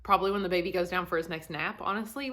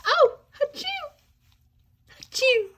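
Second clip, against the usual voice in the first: playful fake sneeze; 8 dB louder than usual.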